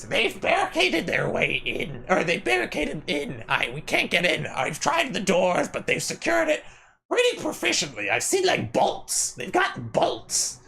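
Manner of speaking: high, frantic goblin voice. Acted. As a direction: pitch way up into a jittery goblin. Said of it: "a goblin that just goes".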